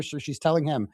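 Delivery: ordinary talk, nothing marked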